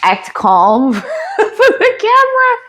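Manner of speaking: breaking into laughter; laughing. Real, not acted. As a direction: laugh through the words, pitch jumping up. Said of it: "I'm trying to, like, act calm".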